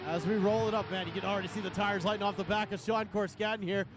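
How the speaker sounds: high, building announcer call; dueling hype announcers. Acted. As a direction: hype up the crowd as the drivers finish. Live drift commentary, crowd call.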